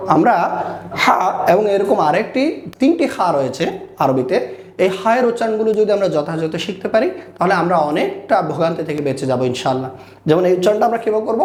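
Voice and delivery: normal talking voice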